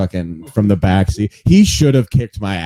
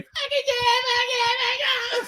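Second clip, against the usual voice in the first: very high squealed moans; porn moan; 6 dB quieter than usual. Retold or imitated. Porn audio imitated.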